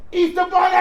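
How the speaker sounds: put-on loud high shout of excited kids; kids yelling. Kids in the park spot the Easter Bunny, loud excited shout. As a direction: yell it like a crowd of kids spotting him. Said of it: "this is all I can hear".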